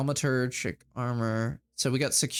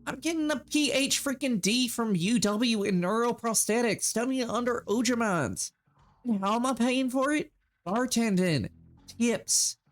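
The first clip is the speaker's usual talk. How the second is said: faux Southern accent; 10 semitones higher than usual. Bartender pushing back at Shane in faux Southern accent. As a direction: push back in a mock Southern voice. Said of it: "You like my faux Southern accent?".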